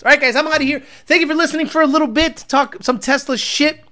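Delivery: normal talking voice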